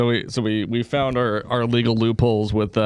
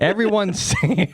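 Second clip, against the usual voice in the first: higher Canadian-Texan lilt, breaking into laughter; Texan-Canadian accent; 7 semitones higher than usual. Acted. Canadian Texan telling buddy, laughing accent. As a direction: do Canada-Texas accent, about to crack up. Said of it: "That's pretty good".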